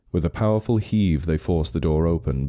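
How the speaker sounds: ordinary delivery, nothing marked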